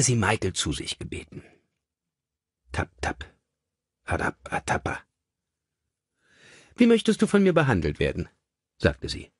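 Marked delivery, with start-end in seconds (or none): 2.7-5.0 soft unvoiced tap-tap; whispered tapping noise. A narrator's reading. Narrator imitating a faint tapping sound, whispered. 6.8-8.3 lady's voice, high and sweetly brisk; high voice for Lady Magna. A narrator's reading. Mother asking her son how to treat him, high and sweet.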